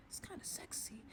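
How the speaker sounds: breathless whisper; a private thought, whispered; whispered aside. Retold or imitated. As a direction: drop to a secret whisper. Aside to the listener.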